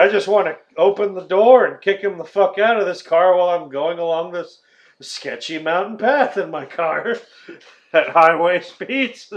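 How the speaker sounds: mock exasperated outburst; fed up. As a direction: vent like a driver at the end of his patience. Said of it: "he is already sick of them".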